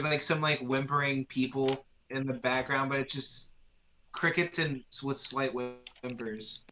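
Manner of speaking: ordinary talk, nothing marked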